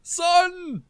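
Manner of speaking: high drawn-out yell for help; loud, high-pitched call. Acted. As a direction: holler up to your son, stretching the words out. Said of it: "I'm gonna yell".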